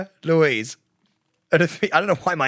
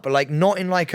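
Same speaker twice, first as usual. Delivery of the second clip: ordinary talk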